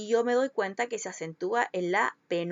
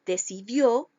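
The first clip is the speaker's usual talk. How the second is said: "decidió" with a strong, louder DIÓ; stress on last syllable; 4 dB louder, slower than usual. Shown as a demonstration. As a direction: land hard on the end of "decidió". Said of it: "Las palabras agudas llevan tilde". ("decidió" con acento fuerte al final)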